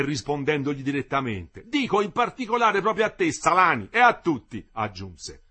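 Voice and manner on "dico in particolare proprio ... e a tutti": loud, higher angry reproach; indignant tirade. A narrator's reading. The engineer keeps berating the workers indignantly. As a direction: scold them for ingratitude.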